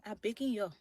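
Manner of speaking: Liberian English, pleading; Liberian accent. Shown as a demonstration. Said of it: "I'm gonna put in my Liberian accent".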